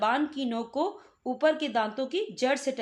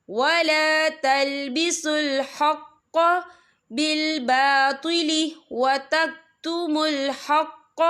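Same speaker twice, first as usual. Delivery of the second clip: slow, drawn-out tajweed chant; Quran recitation; 2 semitones higher, 6 dB louder, much slower than usual. Shown as a demonstration. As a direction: recite it slowly and melodiously. (धीमी तिलावत)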